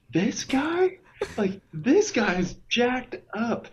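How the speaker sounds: high, drawn-out mock disbelief; incredulous. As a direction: retell it in squeaky comic shock. Retells shock at leader's confession.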